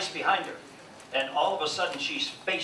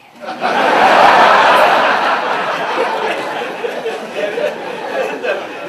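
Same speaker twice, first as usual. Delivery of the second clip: big laugh; 9 semitones higher, 13 dB louder than usual. Real, not acted. Laughs after wife joke.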